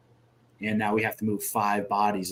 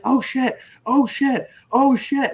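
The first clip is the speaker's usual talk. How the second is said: high, loud imitation of a panicked casualty; repeated mock cries of alarm, pitched way up; retold screaming; 14 semitones higher, 6 dB louder, slower than usual. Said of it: "the dude's screaming on the freaking ladder".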